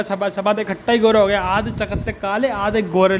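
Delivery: ordinary talk, nothing marked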